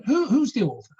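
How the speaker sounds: imitating an old lady's question; old lady asking.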